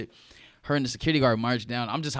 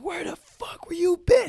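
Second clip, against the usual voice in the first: mock mom yell, much higher; mom screaming. Imitating his angry mom in the hallway, high mock yell. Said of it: "She's screaming".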